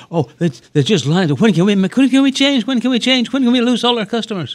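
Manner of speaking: high, loud sarcastic mock quote; mock the CEO, shrill and loud.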